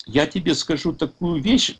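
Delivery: usual speaking voice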